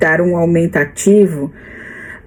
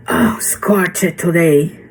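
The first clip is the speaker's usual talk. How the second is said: "scorcher" drawled slowly in a light Australian accent; slow, easy Aussie delivery; mock Australian; slower than usual. Shown as a demonstration. Teacher shows how Australians say scorcher. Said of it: "é o jeito que eles falam".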